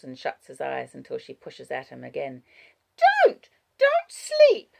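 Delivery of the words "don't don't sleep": put-on very high, loud child's shout; urgent child. A narrator's reading. Child pushing at Wallace, high urgent cry. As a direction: order him awake in a high child voice.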